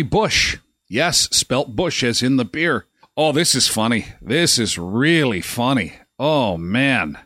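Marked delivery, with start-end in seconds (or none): none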